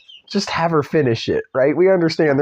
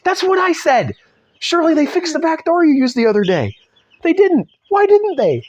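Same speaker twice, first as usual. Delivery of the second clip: much higher, louder and incredulous; hammed-up disbelief at the obvious; a squeaky cry of how could they not; exasperated mock outrage; 5 dB louder than usual. Mocking the book's logic, exasperated.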